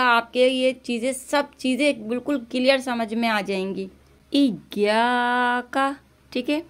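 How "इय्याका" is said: slow recitation, इय्याका with ya doubled clearly. Shown as a demonstration. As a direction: hold the shadda in इय्याका. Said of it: "हम तशदीद को जाहिर करेंगे". (इय्याका में तशदीद ज़ाहिर करना)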